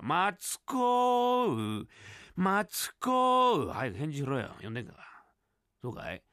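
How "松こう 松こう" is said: stumbling word by word, very slow, oddly voiced; halting slow reading. Barbershop customer struggling to read a book aloud, very slow and halting. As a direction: sound out each syllable like a poor reader.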